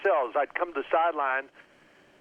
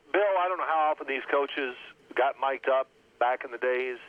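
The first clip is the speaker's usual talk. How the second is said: ordinary delivery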